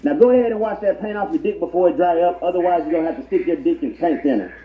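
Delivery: ordinary talk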